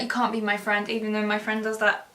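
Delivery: ordinary talk, nothing marked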